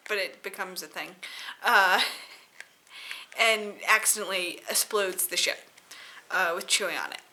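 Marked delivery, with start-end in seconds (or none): none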